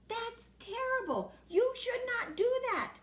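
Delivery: lightly voiced high old man telling off; a hint of a shocked grandfather scolding; mild stern reproach. A narrator's reading.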